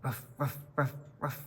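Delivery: low mock dog woofs; dog barking. Storyteller playing a dog for kids, low woofs. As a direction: bark like a friendly dog.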